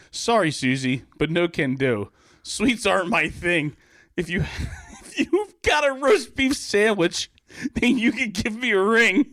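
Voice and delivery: lightly voiced gym teacher laughing; [name] the gym teacher. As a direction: turn her down with a chuckle.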